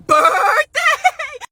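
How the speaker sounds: very high, loud tearful shriek; wailing outburst. Acted. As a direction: scream the word in a hammed-up wail. Customer's rant peaks.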